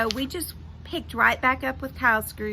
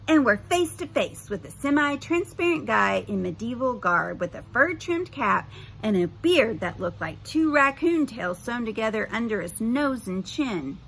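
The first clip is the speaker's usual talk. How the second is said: ordinary delivery, nothing marked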